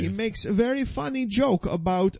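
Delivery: put-on high, earnest Brazilian-accented voice; hammed-up Brazilian fighter explaining a joke; mock Brazilian accent. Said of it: "and they talk like this".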